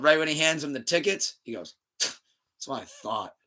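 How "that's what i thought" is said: soft, slow, drawn-out gloat; low-key mock smugness, stretched out; smug retort. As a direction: imitate a friend quietly having the last word. Friend's smug remark taking the tickets.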